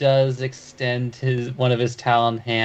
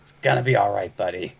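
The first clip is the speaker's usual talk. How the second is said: gruff voice; 3 semitones lower, much faster than usual. Acted. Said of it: "in a gruff voice".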